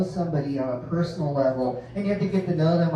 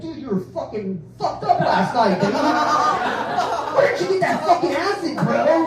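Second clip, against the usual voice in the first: loud, high, laughing party bro voice; hammed-up stoner friend, much higher and louder; 8 semitones higher, 4 dB louder than usual. High-school buddy on the phone teasing the dad, in a loud high bro voice.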